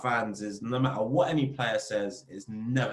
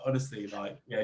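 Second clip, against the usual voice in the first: usual speaking voice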